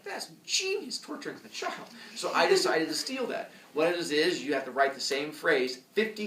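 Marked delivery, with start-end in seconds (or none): 0.0-1.9 gleeful scheming. Retold or imitated. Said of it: "I was like".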